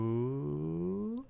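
long rising soft "ooh"; drawn-out taunting ooh, sliding upward; crowd "ooh". Retold or imitated. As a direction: imitate onlookers reacting to a fight. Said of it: "they're all saying".

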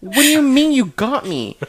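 high-pitched indignant demand; acted incredulous outrage. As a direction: retell it as an offended outburst. Acted outrage at being pranked.

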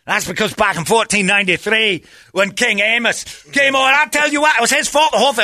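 high, loud mock-Scots rant; strong Scottish accent. Mimicking Scots arguing about old history, ranting Scottish accent. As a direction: build to a shrieking Scottish grievance. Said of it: "that accent got so strong".

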